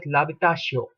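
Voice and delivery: l'habitation with the l joined straight onto the a; silent H. Shown as a demonstration. Teacher explaining the unpronounced H, silent-h pronunciation. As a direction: say l'habitation as "labitasho", dropping the h. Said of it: "H obviously is not pronounced".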